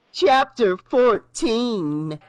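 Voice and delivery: chapter announcement. A narrator's reading. Chapter heading.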